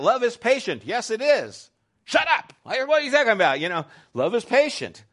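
high, loud mock bickering with himself; mock quarrel. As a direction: snap back at yourself in a silly fake argument.

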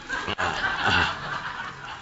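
soft airy chuckle; quiet breathy laugh. Real, not acted. Laughs at stoner remark.

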